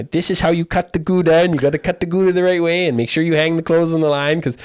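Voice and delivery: high, loud mock Dutch accent. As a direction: imitate a Dutch tutor in accent. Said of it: "with a Dutch accent".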